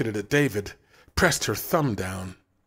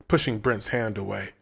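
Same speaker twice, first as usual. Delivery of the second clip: plain reading voice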